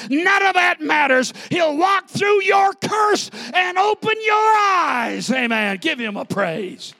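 high, shouted preacher voice with stretched words; roaring revival-style exhortation; full-force preaching climax. Real, not acted. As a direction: shout the promise home to the whole congregation.

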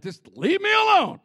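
a cry to be left alone; exasperated cry. Retold or imitated. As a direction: beg to be left alone, loud. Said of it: "Sometimes you're like".

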